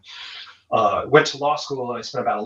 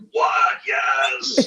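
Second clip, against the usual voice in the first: retold excited shout. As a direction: burst out in thrilled disbelief. Thrilled at narrator's accent choice.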